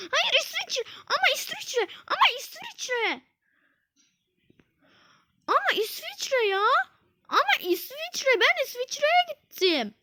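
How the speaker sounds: high, insistent whining; whiny protest. Real, not acted. Gamer whining that he chose Switzerland. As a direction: whine it again and again.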